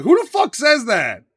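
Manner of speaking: high, loud, drawn-out disbelief; loud incredulity. Real, not acted. Mocking a movie line to co-hosts, loudly incredulous. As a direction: exclaim it slowly, utterly baffled.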